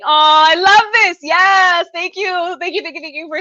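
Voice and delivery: delighted. Real, not acted. Thanking viewers for birthday love, delighted. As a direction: burst out happily at the comments.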